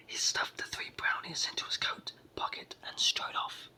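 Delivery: ordinary delivery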